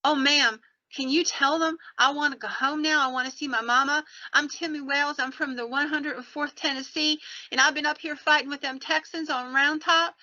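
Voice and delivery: put-on high, pleading young Southern soldier; Southern accent. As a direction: imitate a homesick Southern boy begging for help. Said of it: "with his Southern accent in place".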